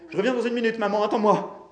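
high, loud call; crying out. A narrator's reading. Bailey led into the woods, crying out. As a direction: shout back to your mother from afar. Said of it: "et cria".

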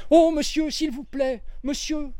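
a small maid calling out urgently; little girl crying out. A narrator's reading. Little maid Annie calling out to Poirot, high and pleading. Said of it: "s'écria une petite personne".

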